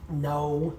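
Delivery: ordinary talk, nothing marked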